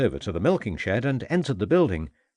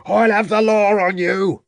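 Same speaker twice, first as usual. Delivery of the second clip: much higher, loud raging threat; furious shout; 11 semitones higher, 6 dB louder than usual. A narrator's reading. Farmer yelling threats at the inspector from the cart. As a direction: yell it as they drag him away.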